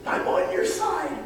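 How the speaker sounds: root for her like a coach from the sideline; eager encouragement. Acted. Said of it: "he looks at her and says".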